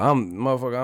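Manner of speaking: ordinary talk, nothing marked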